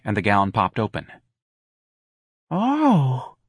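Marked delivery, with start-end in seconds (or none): 2.5-3.4 high, drawn-out "oh" sigh; performer's put-on sigh; stagey sigh. A narrator's reading. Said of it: "Darlene sighed".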